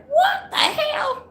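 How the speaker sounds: shrieking what the hell, way up high; incredulous high squeal; high-pitched shriek. Real, not acted. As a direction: squeal it in disbelief. Reading a funny question, shrieking.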